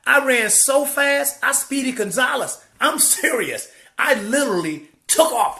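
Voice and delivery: high, loud and thrilled; telling it like a breathless chase; excited. Retold or imitated. Excitedly retelling his run.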